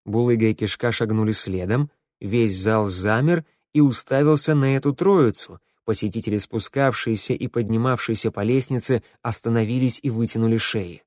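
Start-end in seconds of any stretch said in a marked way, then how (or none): none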